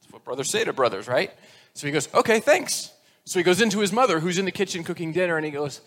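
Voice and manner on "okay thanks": put-on cheerful little boy. Boy in joke thanks his brother.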